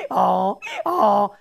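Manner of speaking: mock donkey "aw, aw" bray. Preacher imitates Bugs Bunny turned donkey, mock bray. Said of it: "his head would turn into a donkey".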